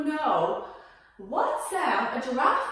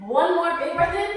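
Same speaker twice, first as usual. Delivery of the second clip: ordinary talk, nothing marked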